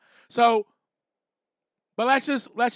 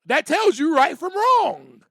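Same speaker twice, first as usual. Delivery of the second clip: full preaching cadence; 6 semitones higher, 5 dB louder than usual. Real, not acted. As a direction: proclaim it from the pulpit. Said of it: "Don't make me start preaching up here".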